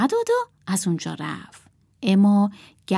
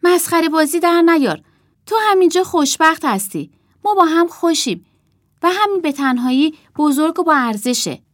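put-on higher, louder pleading girl; girl puppet voice; 8 semitones higher, 6 dB louder than usual. Puppet girl begs the boy to stay, pleading. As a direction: voice the girl telling him to stay. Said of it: "دخترک گفت".

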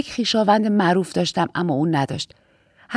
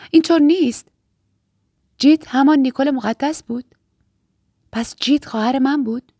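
higher, questioning shock; protest, then puzzle it out in a raised pitch; incredulous, raised-pitch reaction; stunned disbelief; 4 semitones higher than usual. Acted. Sister revelation met with disbelief.

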